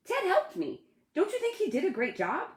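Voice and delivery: put-on high, eager boy voice; beaming kid showing off; proud child.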